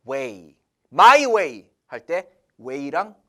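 slow "weigh", no g or h sound, just like "way". Shown as a demonstration. Teacher showing students the silent gh, saying "weigh" slowly like "way". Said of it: "지에이치 발음이 없어요".

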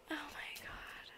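slow whispered exasperation; exasperated whisper. Real, not acted. After her mom interrupts filming, whispered exasperation. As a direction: breathe it out under your breath, fed up.

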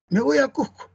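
imitating a Lima speaker who drops the s in "Cusco"; Lima accent imitation. Teacher imitating a limeño going to Cusco, with a breathed-away s. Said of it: "Míralo cómo dice la palabra Cusco".

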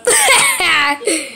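short burst of acted laughter; laughing. Friend hearing of the burping problem, laughing. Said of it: "I laughed also".

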